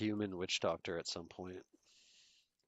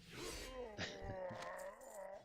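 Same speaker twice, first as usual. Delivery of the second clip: a quick soft laugh pitched way up, falling away; high soft laugh; 13 semitones higher, 11 dB quieter than usual. Real, not acted. Streamer laughing at own joke about devs, high soft laugh. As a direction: let a high giggle slip out and fade.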